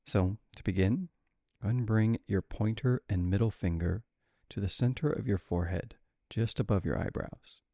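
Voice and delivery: calm, measured cues with long pauses; guided breathing meditation. Real, not acted. Meditation guide leading alternate nostril breathing, calm and spacious.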